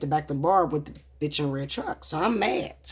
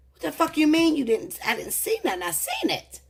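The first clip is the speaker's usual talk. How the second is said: furious outburst; 11 semitones higher than usual. Retold or imitated. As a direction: blow up at him, voice shooting up high. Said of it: "That blew me through the roof".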